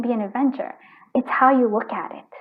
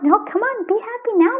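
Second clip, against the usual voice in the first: voiced much higher, eager and pushing; excited urging; 11 semitones higher, 4 dB louder than usual. Spirit urging the viewer to be happy now, excited and high-pitched. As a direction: cheer them into being happy now.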